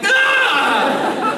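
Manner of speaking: high, exasperated comic scream; mock scream. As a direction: scream in exaggerated agony at the irony.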